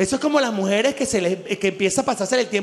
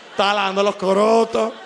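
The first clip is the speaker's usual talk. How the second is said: ordinary talk, nothing marked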